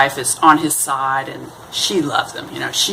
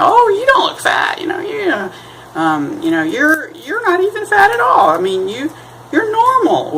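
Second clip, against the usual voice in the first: high, hammed-up denial, louder; mock reassurance; 3 dB louder than usual. Friend sparing a man's feelings, hammed-up high. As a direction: overdo the polite lie way up high.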